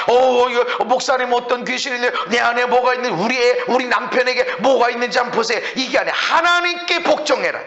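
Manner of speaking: high, driving sermon delivery; full-force preaching. Real, not acted. Preacher urging the congregation to resist the devil, full-force preaching.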